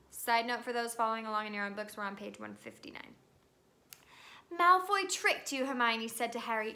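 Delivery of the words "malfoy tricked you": high, loud reproach; scolding. Acted. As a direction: tell him off sharply. Hermione scolding Harry.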